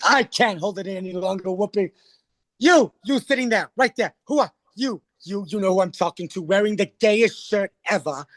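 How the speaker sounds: single out a stranger in mock outrage; calling someone out. Star calls out the man in the shirt, mock-indignant.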